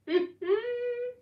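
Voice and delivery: high incredulous laugh. Real, not acted. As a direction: laugh out in disbelief. Laughing at friend story.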